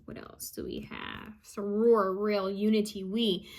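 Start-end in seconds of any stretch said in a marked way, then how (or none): 0.0-1.3 soft whisper to herself; whispered aside. Real, not acted. Medium whispering to herself between messages, hushed. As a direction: breathe it out as an aside.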